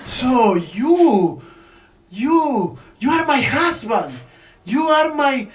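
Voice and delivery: high, loud, indignant complaint; angry wife. Retold or imitated. Parvati scolds her husband Shiva, high angry shout. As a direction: shout it at him, stung and betrayed. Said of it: "Parvati very angry".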